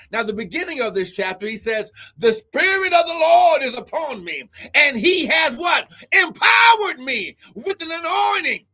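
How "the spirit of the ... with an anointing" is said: higher and louder, thundering the verse; proclaiming shout. Real, not acted. Preacher thundering Isaiah to the congregation, proclaiming shout. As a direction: declare the scripture like a trumpet. Proclaiming scripture at full force.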